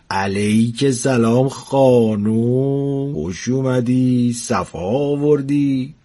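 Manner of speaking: very slow, syrupy courtly welcome; smarmy flattery. Acted. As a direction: charm the pretty guest slowly. Old man flattering angel. (خوشامدگویی چاپلوسانه و خیلی کشیده)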